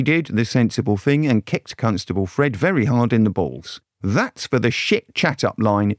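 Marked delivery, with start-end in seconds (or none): none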